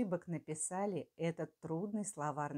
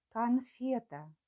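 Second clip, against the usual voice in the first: dictating; 5 semitones higher, 3 dB louder, slower than usual. Shown as a demonstration. Dictation, word said twice.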